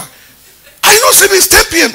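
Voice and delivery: father questioning his daughter late at night, high and loud; mock scolding father. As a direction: play the surprised dad catching her awake. Pastor voicing himself to daughter.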